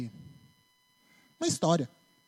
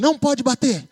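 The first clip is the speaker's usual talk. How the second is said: loud, higher scolding "não pode"; mock-stern parent reprimand; 7 semitones higher, 7 dB louder than usual. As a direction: snap the rule at the child. Parent scolding child after slapping.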